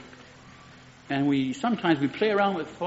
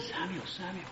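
much softer, gentle calling of the name; soft still voice; 13 dB quieter than usual. Shown as a demonstration. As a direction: call him softly like a still small voice. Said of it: "a still small voice".

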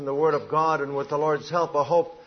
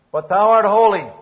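But thou art holy, much higher and louder, ringing out; proclaimed loudly; 7 semitones higher, 11 dB louder than usual. Shown as a demonstration. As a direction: lift the voice on art and declare it. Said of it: "repeated with contrast".

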